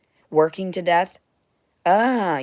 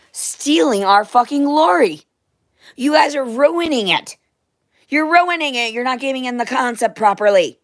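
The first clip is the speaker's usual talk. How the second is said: higher, louder indignant yelling; furious shout; 7 semitones higher, 8 dB louder than usual. Real, not acted. To the Galactic Federation, a higher furious yell. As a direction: let them have it, outraged.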